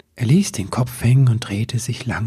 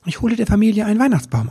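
high, rushed little elf; eager elf voice; 10 semitones higher, much faster than usual. A narrator's reading. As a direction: blurt it out fast and proud. Elf answers proudly.

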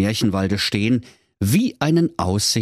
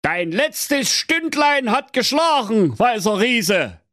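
high, slow mock-solemn threat; heroic threat. A narrator's reading. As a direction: pronounce doom like a pompous knight.

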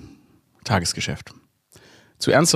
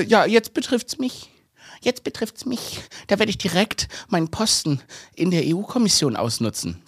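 mocking politician voice; 7 semitones higher than usual. Imitating outraged von der Leyen.

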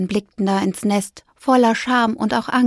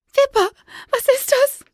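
high, alarmed magpie cry; startled magpie; 3 dB louder than usual. A narrator's reading. Magpie frightened by a hidden voice. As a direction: jump at the invisible ghost.

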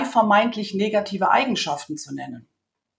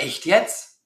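mildly incredulous echo question; questioning retort; 2 semitones lower than usual. Retold or imitated. Man doubting what she told him, questioning. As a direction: ask it back in doubt, rising at the end. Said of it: "Dann sagt er".

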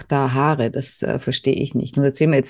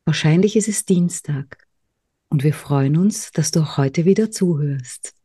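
whispering. Pretend. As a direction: whisper it like a late-night secret. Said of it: "so schön hineingelispelt". (geflüstert)

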